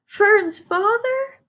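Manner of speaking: a frightened little pig choking it out; sobbed high piglet. A narrator's reading. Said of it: "sobbed Wilbur".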